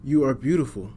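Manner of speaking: plain reading voice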